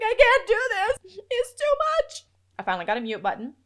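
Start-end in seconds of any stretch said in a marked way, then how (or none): 0.0-2.2 high, loud, slowed wail; hammed-up panicked protest; overwhelmed outcry. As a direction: cry out as if swamped by noise. Acts out her sensory overload.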